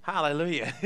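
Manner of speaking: usual speaking voice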